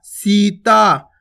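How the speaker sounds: Sita stretched very slowly; drawn out. Shown as a demonstration. Teacher drawing the word out for children. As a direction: hold each vowel of Sita long. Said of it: "खींच के उच्चारण करना".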